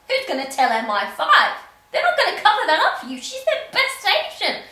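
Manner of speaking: mock-panicked rant. Real, not acted. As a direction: rattle it off in comic alarm. Vlogger ranting, mock panic.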